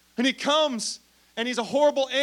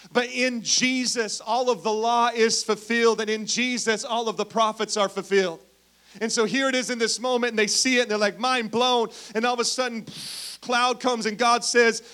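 louder, rising preacher's build; preaching; 4 dB louder than usual. Acted. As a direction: preach it with growing force.

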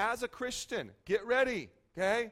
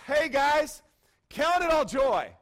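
loud, higher-pitched hail to a crowd; rallying call; 7 semitones higher, 9 dB louder, slower than usual. Acted. Preacher voices James hailing the believers, rallying call. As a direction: call it out big and high.

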